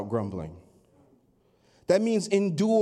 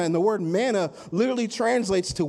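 ordinary delivery

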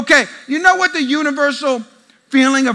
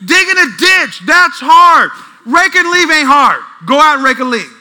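loud fed-up dad rant; exasperated scolding; 3 semitones higher, 6 dB louder than usual. Retold or imitated. Dad comparing chores for his sons, exasperated loud scolding. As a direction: lecture the kids at a holler.

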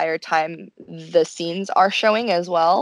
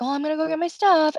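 put-on high girly voice; 8 semitones higher, faster than usual. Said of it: "Haruka's like".

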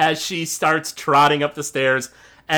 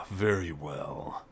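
low, slow, menacing orc voice; gruff orc boss; 9 semitones lower, 12 dB quieter, slower than usual. Acted. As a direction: growl it slowly through a door. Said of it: "the gruff voice of Lockgrim".

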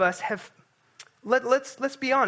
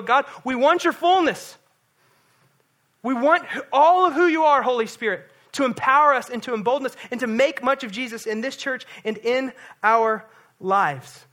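louder and higher, fervent praying out; bold prayer; 6 semitones higher, 8 dB louder than usual. Pretend. Pastor modelling a bold prayer for the church, fervent and loud. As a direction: cry out a bold prayer, slowing at the end. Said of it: "getting out of our comfort zones".

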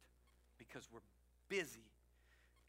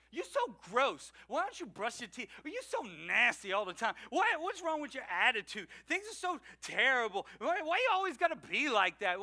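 higher, louder scornful nagging; a nagging critic picking someone apart, louder and higher; mock nagging; 5 semitones higher, 6 dB louder than usual. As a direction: pile on the insults like a whiny critic.

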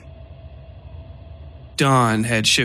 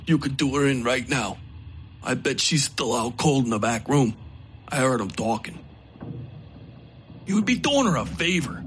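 wounded outlaw voice; 6 dB quieter than usual. A narrator's reading. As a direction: play the injured gunman, resentful. Narrator voices wounded Coakley.

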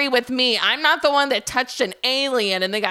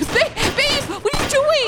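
high-pitched alarm at a partner; panicked cry; 12 semitones higher than usual. Acted. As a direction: call out in shrieking panic. Partner fires at the alien.

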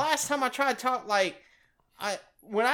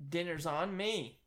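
cheesy pickup line; 4 semitones lower, 6 dB quieter than usual. Pretend. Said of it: "what did one plate say".

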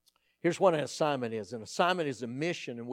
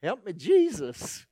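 comic plea; 5 semitones higher, 4 dB louder, slower than usual. Real, not acted.

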